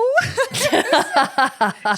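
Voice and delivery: loud high burst of laughter; real delighted laugh. Real, not acted. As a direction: laugh out at the punchline. Laughing at the joke.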